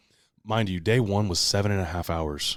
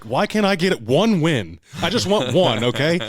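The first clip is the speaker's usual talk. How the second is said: hammed-up sore-loser whine; comic exasperation; 10 semitones higher, 9 dB louder than usual. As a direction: plead with the universe at full volume. Acted complaint about never winning.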